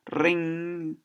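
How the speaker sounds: ordinary delivery, nothing marked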